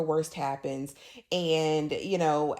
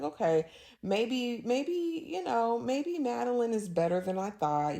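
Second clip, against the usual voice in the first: grudging reconsideration; 5 semitones higher than usual. Retold or imitated. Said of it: "I was like".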